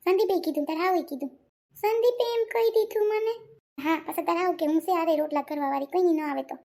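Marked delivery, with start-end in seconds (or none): 1.8-3.3 higher-pitched, upset protest; hurt disbelief. Acted. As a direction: say it stung and disbelieving. Daughter upset by husband's reported words. (દુભાયેલા અવાજે)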